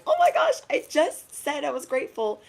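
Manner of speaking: very high, loud squeal of surprise; thrilled high gasping discovery; excited squeal. Acted. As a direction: shriek it out amazed. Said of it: "this magical game of".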